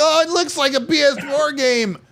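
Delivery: high, whiny put-on voice; mocking complainers. Mimicking everyone reacting to the reveal, mocking whine.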